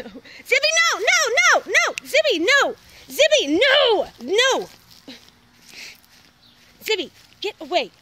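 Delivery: very high, loud, frantic shouting; frantic yelling. Real, not acted. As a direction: scream at the dog to stop. Dog attacking bird, frantic yelling.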